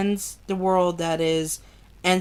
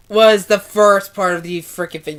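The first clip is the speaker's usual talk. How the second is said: usual speaking voice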